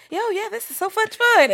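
high-pitched mock enthusiasm; imitating mom. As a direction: play a mom thoroughly enjoying herself. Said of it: "your mom's probably like".

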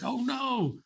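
high-pitched imitated dismay; mock alarm. The other comedian woke during bombings, mock alarm. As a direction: wail it like a worried roommate.

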